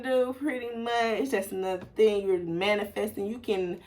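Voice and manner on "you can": ordinary delivery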